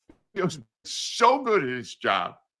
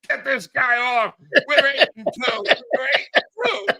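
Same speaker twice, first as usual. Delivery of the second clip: higher, mock-indignant phone demand; mock angry boss; 7 semitones higher than usual. Eagles executive phones the station owners demanding him off, mock outrage. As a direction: play the outraged team executive barking down the phone.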